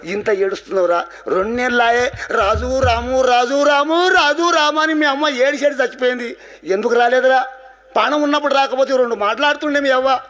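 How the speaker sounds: high, plaintive fatherly scolding; acted reproach. Father to the son who came too late, reproachful.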